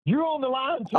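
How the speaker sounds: high-pitched retold yell. Son warning dad about the start line, mock yelling. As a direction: yell the warning high, not at full volume. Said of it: "he's yelling at me".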